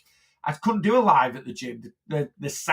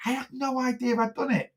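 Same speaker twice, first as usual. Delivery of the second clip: higher, bewildered innocent protest; retold bewildered protest; 9 semitones higher, 4 dB quieter than usual. Retelling his reply to the client, higher and baffled. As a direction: play your past self, baffled and defensive. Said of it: "and I was like".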